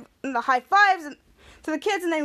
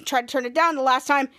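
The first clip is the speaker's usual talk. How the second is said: usual speaking voice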